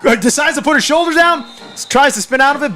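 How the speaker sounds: live football call; excited play-by-play. Real, not acted. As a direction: pitch lifted as the run develops.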